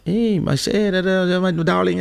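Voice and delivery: high, sweet motherly greeting; lightly imitated mother; mom's voice. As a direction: coo like a doting mom. Voicing his mom.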